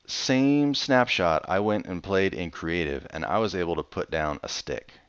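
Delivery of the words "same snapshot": slow emphasis. Real, not acted. Comparing creative mode.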